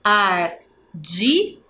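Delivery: ardido stretched by syllable, rising; slow syllables. Shown as a demonstration. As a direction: count it out slowly. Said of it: "tem três sílabas". (devagar, sílaba por sílaba)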